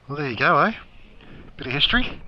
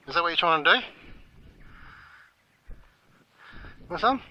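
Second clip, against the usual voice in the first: high cooing voice, softer, for the animals; talking to animals; 8 semitones higher, 4 dB quieter than usual. Acted. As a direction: coo at a sweet creature like a pet. Coaxing animals to eat.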